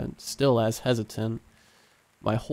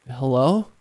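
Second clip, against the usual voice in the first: raised call down a well; 5 dB louder than usual. A narrator's reading. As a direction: call into the dark. Calling down into the well.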